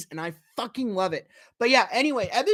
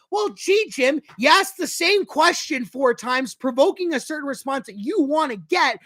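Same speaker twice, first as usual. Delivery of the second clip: angry rant; 6 semitones higher, 3 dB louder than usual. Real, not acted. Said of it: "I'm pissed right now".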